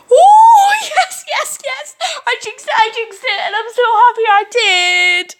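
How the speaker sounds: very high shrieking joy; ecstatic squeal. Real, not acted. Player shrieks happily about the good outcome, very high. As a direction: squeal in triumph.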